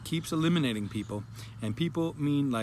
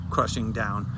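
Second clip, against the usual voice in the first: ordinary talk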